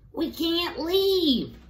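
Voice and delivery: little rat crying out to the captain; Rizzo's squeaky voice. Acted. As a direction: squeak it out in alarm. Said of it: "said Rizzo".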